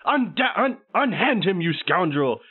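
high, loud heroic challenge; brash old-fashioned hero bravado. Acted. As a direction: play a dashing storybook hero confronting a villain. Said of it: "I'm gonna run up like".

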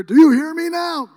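high, loud, slow shout; shouting at a deaf woman in prayer; yelling into her ear. Retold or imitated. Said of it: "I was like yelling in her ear".

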